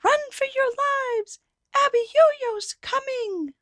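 high, loud, slow panicked call; panicked cry. Acted. Townsfolk warning everyone the giant is coming. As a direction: cry out in terror. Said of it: "Strong men fainted".

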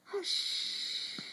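high, breathy whispered hush. Acted. Reading to baby, whispering the old lady's hush. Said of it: "old lady who was whispering".

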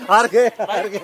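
loud, high taunting chant; laughing taunt at a beaten player; gleeful jeer. Real, not acted. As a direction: crow over the loser, laughing. Jeering at the loser of match.

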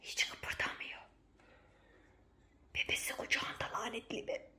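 tense hushed whisper. Real, not acted. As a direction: whisper so nobody hears. (fısıldayarak)

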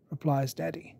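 ordinary delivery, nothing marked

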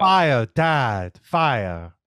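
lightly imitated bad child actor; horrible child acting. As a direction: mimic a stilted line reading. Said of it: "that's some horrible acting".

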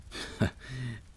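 short high laugh that falls away; a shy little laugh before confessing; nervous laugh. Real, not acted. About to confess his feelings, nervous laugh.

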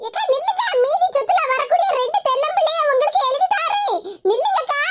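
high, urgent pleading; pleading call. Acted. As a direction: beg a runaway to wait. Pleads with runaway, offering bribe.

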